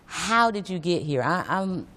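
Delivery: normal talking voice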